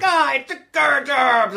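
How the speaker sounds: high, drawn-out slurred holler; hammed-up yokel outrage, words stretched and mangled. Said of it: "these rednecks come".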